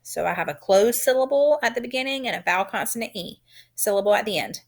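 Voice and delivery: ordinary talk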